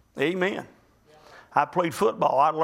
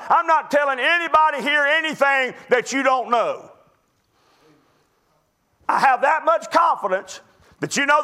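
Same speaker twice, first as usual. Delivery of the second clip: fervent preaching; 6 semitones higher, 3 dB louder than usual. Acted. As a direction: preach it to the congregation with conviction. Preacher addressing congregation.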